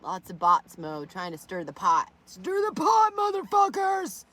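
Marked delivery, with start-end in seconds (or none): 2.2-4.2 higher, louder mock taunt; acting. Taunting bots in chat with a higher hammed-up cry. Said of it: "You should be acting".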